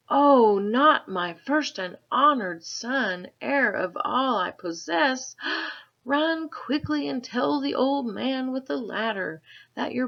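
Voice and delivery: high, loud cry of alarm; a mother suddenly frightened; mother panicking, sending her son for help. A narrator's reading.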